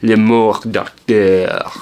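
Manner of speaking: very slow, amateur French accent; best French accent. Shown as a demonstration. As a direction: stretch out each word in your best attempt at French. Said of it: "it's my best French, French accent".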